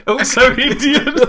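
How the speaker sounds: high, loud, drawn out through laughter; laughing. Real, not acted. Conceding co-host's tease about the accent, laughing hard. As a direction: burst out laughing on the words.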